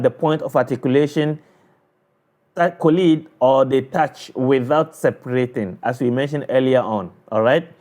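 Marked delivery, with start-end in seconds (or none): none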